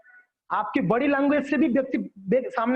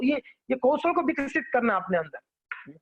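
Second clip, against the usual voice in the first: normal talking voice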